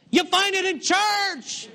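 high, loud, slow preaching shout; preacher's shout. Acted. Preacher shouting at a churchgoer who stays home, loud and slow. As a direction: fire it at the doubter from the pulpit.

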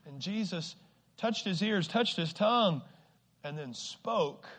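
normal talking voice

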